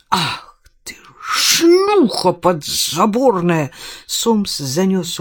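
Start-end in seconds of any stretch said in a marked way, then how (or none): 0.1-3.7 slow, furious outburst; enraged insult. Acted. Father lashing out at his daughter, slow furious cry. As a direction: spit the insult out in rage.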